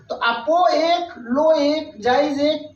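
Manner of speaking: ordinary talk, nothing marked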